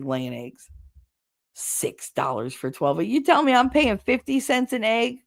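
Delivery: ordinary delivery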